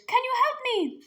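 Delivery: very high, pleading whimper. Acted. Trapped dragon to the girl, whimpering plea. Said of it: "a muffled voice whimpered".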